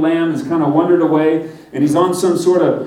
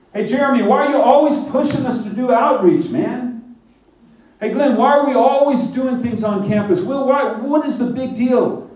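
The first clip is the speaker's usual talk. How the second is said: higher, mock-whiny protest; whiny complaint; 8 semitones higher than usual. Students griping to Jeremy about outreach, mock-whiny. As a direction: gripe like a student dragged to outreach.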